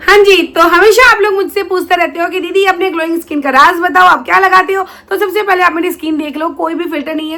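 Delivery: loud, bright sponsored pitch to viewers; upbeat ad read. Acted. Vlogger pitching a skincare app to her viewers, loud ad read. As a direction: sell the product like a promo.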